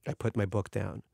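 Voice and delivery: ordinary delivery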